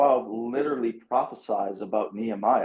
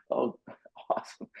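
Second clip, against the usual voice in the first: whispered with a laugh, dry sarcasm; a laughing whispered oh awesome, plainly not meant; sarcastic whisper; 8 dB quieter than usual. Retold or imitated. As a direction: breathe it out, mock delight. Sarcastic reply to pointing out sins.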